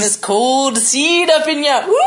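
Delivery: high, slow creepy villain. Retold or imitated. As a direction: leer like a sleazy villain. Imitating Randall Flagg.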